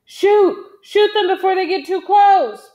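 high, loud urgent command; roaring. A narrator's reading. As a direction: roar the order to fire. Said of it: "Big John roared".

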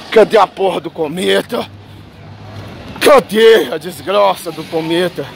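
low, groaning lament; weary despair. Real, not acted. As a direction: plead for doom in a low, fed-up moan. Despairing at humanity, wishing for a comet.

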